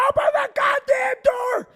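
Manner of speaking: high, loud, frantic yell at the door; desperate shout. Retold or imitated. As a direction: hammer the words like pounding the door. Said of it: "I'm like".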